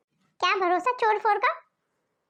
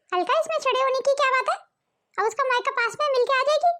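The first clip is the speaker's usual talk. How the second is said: raised, higher pitched motherly retort; mother-in-law arguing; 5 semitones higher than usual. Acted. Mother scolds daughter Alka, a raised higher motherly retort. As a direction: push back hard at the daughter.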